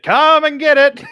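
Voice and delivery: high, drawn-out holler to the table; hammed-up chow-time yell; dinner-bell call. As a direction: call everyone in for supper, loud and stretched out. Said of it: "just starts clanging it".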